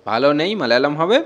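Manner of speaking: ordinary delivery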